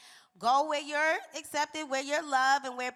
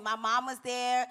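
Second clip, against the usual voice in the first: normal talking voice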